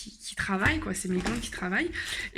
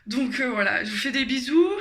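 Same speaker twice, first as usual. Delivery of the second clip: breathless, gasping for air between words; out of breath; 4 semitones higher, 7 dB louder than usual. Real, not acted. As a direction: talk while catching your breath. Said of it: "Je suis essoufflée".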